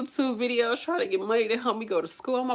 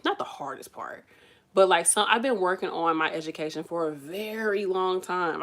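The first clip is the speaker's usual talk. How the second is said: ordinary delivery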